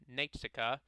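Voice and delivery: usual speaking voice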